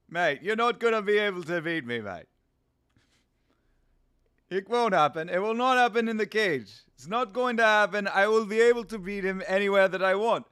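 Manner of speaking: South African accent impression; confident fighter talk in a South African accent; Dricus. Fighter vowing to win in the cage, South African accent. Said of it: "that's not a bad Dricus".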